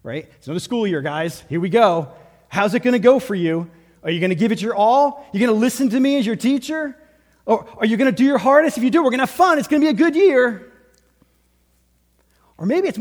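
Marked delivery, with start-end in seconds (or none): 0.4-10.7 fast, higher, louder cheerful teacher; teacher's pep talk. Retold or imitated. Teacher rallying the class on the first day, fast and bright. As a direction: fire up the class on day one. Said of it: "your teacher ... did something like that".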